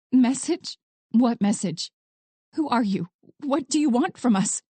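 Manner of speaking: bewildered run of questions; shocked, urgent questioning; panicked babbling. A narrator's reading. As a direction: fire off questions in rising panic. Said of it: "She was babbling now".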